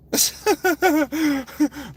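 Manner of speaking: high, loud disbelieving laugh; real laugh of disbelief; incredulous laugh. Real, not acted. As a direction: burst out laughing at the absurdity. Laughs at absurdity of father.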